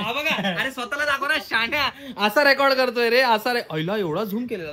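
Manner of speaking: shouting while laughing; loud laughing rant. Real, not acted. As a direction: yell it through laughter. Loud laughing scolding at camera.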